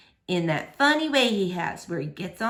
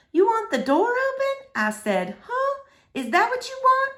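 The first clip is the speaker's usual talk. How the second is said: coaxing; 12 semitones higher than usual. Acted. Girl coaxes scared dog.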